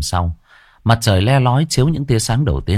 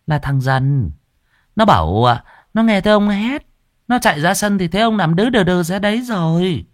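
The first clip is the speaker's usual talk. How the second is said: put-on young wife voice; 9 semitones higher than usual. Third wife voiced in story.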